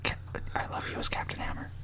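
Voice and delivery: hushed whisper. Real, not acted. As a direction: whisper it quietly. Whispered chat before recording.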